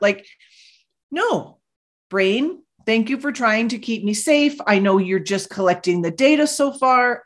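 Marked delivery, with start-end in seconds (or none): 1.1-1.6 a sharp, high "no"; dismissive no, pitched up; firm no. Retold or imitated. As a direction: cut the doubt off with a quick high no. Rejecting the brain's doubts.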